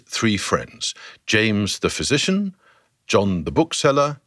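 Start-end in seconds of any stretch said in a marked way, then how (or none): none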